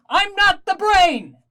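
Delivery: high, slow, put-on voice; drawn-out and loud, like an innocent organ; penis voice. The penis talking back to him, slow and high.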